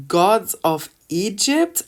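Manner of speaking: slow, loud "Gods of Egypt", rising on "Egypt"; incredulous, drawn-out "Gods of Egypt?"; indignant disbelief. Real, not acted. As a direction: stretch "Gods of Egypt" into an outraged question. Indignant repeat of film title.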